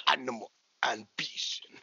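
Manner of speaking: low, slow, soft mock Nigerian accent; Apollo Crews. Host repeats wrestler impression, low soft Nigerian accent. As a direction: draw it out quietly in a Nigerian accent. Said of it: "that's what he was sounding like".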